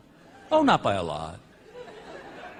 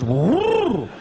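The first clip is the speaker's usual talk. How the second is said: whoop. Retold or imitated. Takeoff whoop on parasail.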